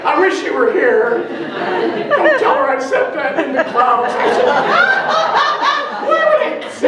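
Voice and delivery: talking through laughter; can barely get it out for laughing; giggling confession to the congregation. Real, not acted. Laughing about Jenny with congregation.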